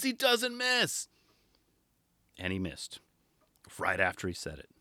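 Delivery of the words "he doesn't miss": higher, softer, very slow knowing call; higher softer very slow mock commentator. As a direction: drag it out softly, smug. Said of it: "But it was hilarious".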